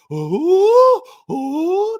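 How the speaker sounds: high loud mocking ooh; mock ref complaint. Mocking the old coach whining at refs, high ooh. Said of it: "giving the butthole face to the refs".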